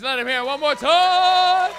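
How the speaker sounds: high, loud drawn-out crowd call; hype shout. Real, not acted. Host calls for applause for a comedian, hype shout. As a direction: stretch the last words into a roar.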